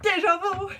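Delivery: high, loud, delighted cry; excited retold outburst. Recognising an anime opening with friend, excited high shout.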